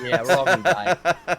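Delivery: slow, loud mock dread; mock doom. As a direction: drag it out like the end is near. Joking doom about apocalypse.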